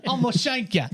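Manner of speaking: high, hammed-up British accent; mock British street threat, half laughing; British Wolverine. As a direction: threaten like a cartoon British thug. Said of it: "it sounds British".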